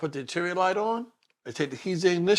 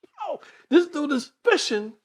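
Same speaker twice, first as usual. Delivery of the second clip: rising indignation; 11 semitones higher, 6 dB louder than usual. Retold or imitated. Confronting the cop, rising shock.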